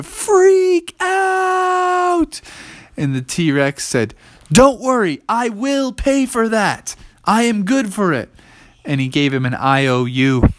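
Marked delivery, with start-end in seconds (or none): 0.0-2.4 suddenly very high, stretched yell; hammed-up drawn-out scream; panicked shriek. A narrator's reading. Narrator acts out clerk's panic. 4.5-8.3 high, emphatic dinosaur voice; high-pitched, insistent assurance; reassuring T-Rex. Acted.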